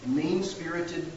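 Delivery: normal talking voice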